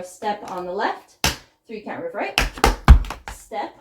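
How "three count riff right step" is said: ordinary delivery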